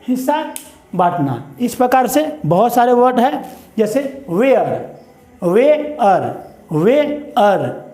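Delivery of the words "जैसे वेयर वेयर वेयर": "वेयर" stretched very slow, "वे-अर"; slow repeat-after-me. Shown as a demonstration. Teacher modelling "वेयर" slowly for pupils. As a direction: drag "वेयर" out so students copy. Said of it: "मेरे साथ बोलेंगे".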